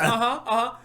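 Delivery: high laughing uh-huh; laughing imitation. Retelling a friend's call, laughing mock uh-huh. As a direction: giggle it out as the friend. Mocking Zach's phone greeting.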